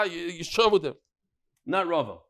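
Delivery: ordinary talk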